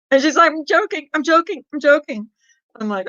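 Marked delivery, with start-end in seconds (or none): 0.5-2.3 high-pitched, repeated backpedal; lightly acted high, teasing insistence; imitated joking reassurance. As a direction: mimic a giggly prankster in a high voice. Acted prankster insisting it was joke.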